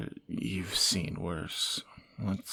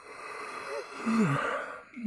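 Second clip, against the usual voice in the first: weary sigh. Real, not acted. Sighs before explaining rhetorical question.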